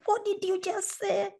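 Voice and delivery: high, drawn-out mock outrage; retold indignant demand. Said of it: "I come down the stairs, and I'm like".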